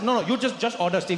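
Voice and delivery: insistent fake Indian accent; impatient Indian-accented urging; put-on Indian accent. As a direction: press him in an Indian accent. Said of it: "I also couldn't stop".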